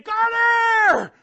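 high full-voice shout; father shouting to stop his running toddler; yelling a name. Retold or imitated. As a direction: bellow the name down the driveway. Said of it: "I yell his name".

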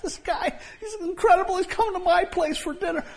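high, laughing, giddy voice; excited, giddy. Retold or imitated. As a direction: bubble over with delight like a thrilled little man. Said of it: "and he's just excited".

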